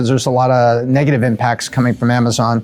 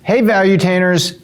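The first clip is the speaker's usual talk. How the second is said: higher, energetic show greeting; upbeat host intro; 4 semitones higher than usual. Shown as a demonstration. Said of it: "One more time".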